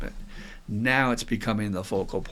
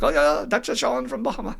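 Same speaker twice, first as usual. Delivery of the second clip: attempted Bahamian accent; 12 semitones higher, 4 dB louder than usual. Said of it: "I can't do an accent".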